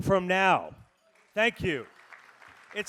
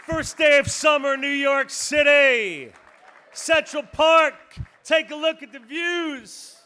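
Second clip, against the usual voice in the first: hype shout; 6 semitones higher, 7 dB louder than usual. Real, not acted. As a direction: sell the moment to the room. Auctioneer hyping crowd.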